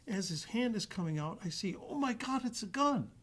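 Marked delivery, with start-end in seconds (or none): 1.9-3.1 much higher, startled cry; the jolt of seeing a gun; sudden alarm. Retold or imitated. Officer in the simulator spotting the weapon, alarmed cry. Sees suspect draw gun.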